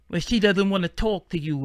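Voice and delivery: a hint of Tyson, high and lispy; Mike Tyson voice. New man on the phone brushing off caller, mock Tyson lisp. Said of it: "however the way he talks".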